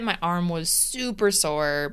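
normal talking voice

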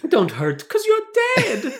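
high, gleeful chef voice; lightly sing-song like the chef; mock French chef. Quoting the crazed chef's lyric, high and gleeful. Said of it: "quoting the chef's song".

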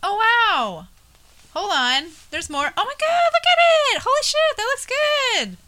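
high, loud, stretched-out gushing; wowed, voice soaring way up and drawn out at the end; real amazed excitement. Real, not acted. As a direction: exclaim in delight at seeing the artwork. Amazed on seeing the tattoo.

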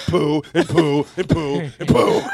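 loud, high rhythmic repeated bursts; mock furious outburst; Joel. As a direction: imitate an angry dad sputtering each poo. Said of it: "he heard Joel going".

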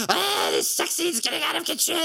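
high, hissing cartoon villain voice; Cobra Commander voice. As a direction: screech it like a snake villain. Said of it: "You sound like Cartman choking on a pretzel".

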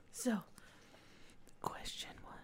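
"question one" whispered right in the mic; whispering. Real, not acted. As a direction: whisper it close to the mic, right in your ear. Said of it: "I got close to the mic and I'm whispering".

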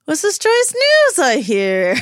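slow, strained cheer forced through a wince; pained fake joy. Niles greeting the reunion news with pained fake joy. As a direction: smile through it like it hurts. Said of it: "wincing, smiling, like it hurts him".

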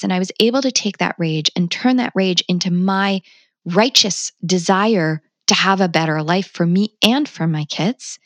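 normal talking voice

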